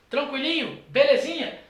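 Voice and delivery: ordinary talk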